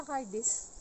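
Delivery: ordinary delivery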